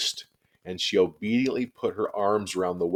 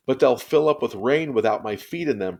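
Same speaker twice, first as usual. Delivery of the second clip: protesting; 5 semitones higher, 6 dB louder than usual. A narrator's reading. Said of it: "protested Ramona".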